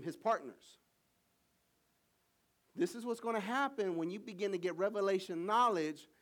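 loud, high preacher's build-up; preaching. Acted. Preacher pressing the congregation, loud full-force preaching.

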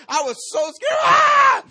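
high, loud hammed-up fearful cry; overdone frightened yell; dramatic scared shout. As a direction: scream it out in mock terror. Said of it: "I was so dramatic".

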